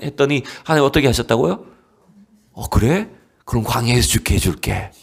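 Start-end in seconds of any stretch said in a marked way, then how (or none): 2.5-4.9 low, dry, matter-of-fact answer; God's reply. Acted. Pastor voices God answering the grumblers, low and dry. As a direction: give them exactly what they asked for. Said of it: "이렇게 하신 거예요".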